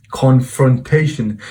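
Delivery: "Confrontation" slowly, syllable by syllable; slow, deliberate. Real, not acted. Naming what he did to the viewer, "Confrontation" drawn out slowly. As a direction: draw out "Confrontation" for emphasis. Word repeated slowly for effect.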